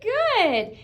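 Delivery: high, slow, enthusiastic praise; excited praise. Acted. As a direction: cheer it like a proud teacher. Teacher praises excited reading.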